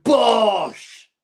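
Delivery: loud high burst through a laugh; a gleeful yelled bosh; laughing shout. Real, not acted. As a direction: shout it out as the punchline. Punchline exclamation with laugh.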